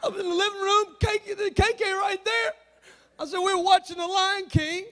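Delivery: high, mock-tearful pleading; whiny protest. Son defending himself to mom over a girl, whiny protest. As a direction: plead your innocence in a very high voice.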